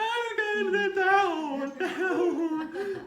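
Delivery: high, slow, loud wail through laughter; high wailing sob. Retold or imitated. Stuck at the top, mock-sobbing about not getting down. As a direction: wail it like a scared child stuck up the mountain. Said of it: "I was crying when I was up there".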